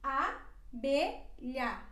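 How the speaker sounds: abelha stretched very slowly, both a open; drawn out so learners hear each open a; slow teaching demo. Shown as a demonstration. Teacher slows abelha right down for students. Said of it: "nós temos duas As abertas".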